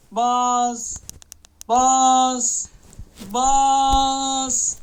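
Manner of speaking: loud yelled command; hyped shout. Real, not acted. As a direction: shout at the button in suspense. Hyping the case opening. (heyecanla bağırarak)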